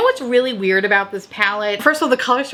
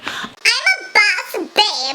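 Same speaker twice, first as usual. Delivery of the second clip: mock girlboss; 12 semitones higher, slower than usual.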